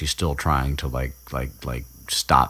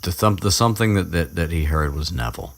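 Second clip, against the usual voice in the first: normal talking voice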